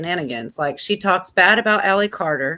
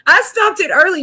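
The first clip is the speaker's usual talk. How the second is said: higher, louder playful call; loud high repeat; 11 semitones higher, 6 dB louder than usual. Real, not acted. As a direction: shout it out, pitched up. Repeats own line, much louder.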